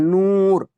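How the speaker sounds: नूर with the long oo stretched out; drawn-out vowel. Shown as a demonstration. Teacher shows students the long waw, drawn-out नूर. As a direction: hold the oo in नूर, slow and full. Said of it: "खींच कर पढ़ा जा रहा है".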